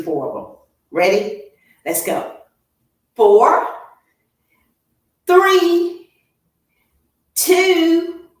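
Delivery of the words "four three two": high, loud shouted counts. Real, not acted. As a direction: push them through the last reps. Coach counting final reps.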